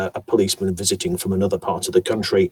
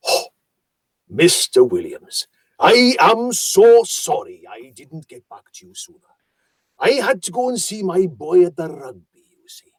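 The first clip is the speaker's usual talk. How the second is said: lilting South Welsh voice, pitched higher; South Welsh accent; 6 semitones higher than usual. Retold or imitated. Solicitor phoning his client back to apologise, South Welsh accent. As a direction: imitate a South Welsh solicitor, savouring every word. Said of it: "the solicitor ... had a wonderful voice".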